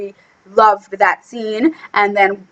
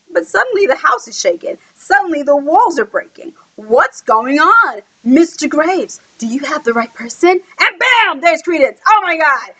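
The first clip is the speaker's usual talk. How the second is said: higher, dramatic climax narration; excited retelling; 4 semitones higher than usual. Real, not acted. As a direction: build the drama with swooping high exclamations.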